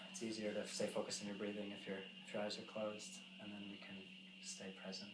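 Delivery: soft meditation voice, trailing into near silence; guided meditation. Real, not acted. Settles group into silent meditation.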